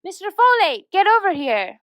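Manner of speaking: high-pitched summons; calling out. Acted. Host calls Mister Folate to come over, calling out.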